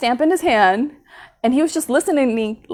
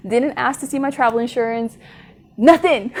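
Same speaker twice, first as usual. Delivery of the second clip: usual speaking voice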